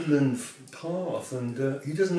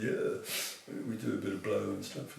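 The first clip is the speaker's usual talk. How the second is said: public school drawl; 4 semitones lower, 9 dB quieter than usual. Retold or imitated. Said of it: "a bit public school".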